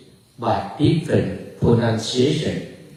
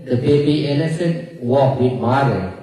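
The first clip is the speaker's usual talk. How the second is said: ordinary talk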